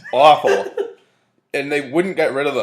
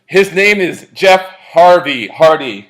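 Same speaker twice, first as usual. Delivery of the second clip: louder, slow announcer voice; mock Mike Adamle announcing; 5 semitones higher, 10 dB louder, slower than usual. Host mimics Mike Adamle botching the name, slow and loud. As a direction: draw it out like a botched intro. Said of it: "he goes".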